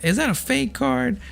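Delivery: high, mock questioning classmate voice; suspicious classmate. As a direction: squeak it out like a nosy kid calling you out. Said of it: "some people would straight up just be like".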